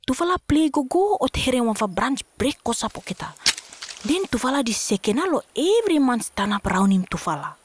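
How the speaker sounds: ordinary delivery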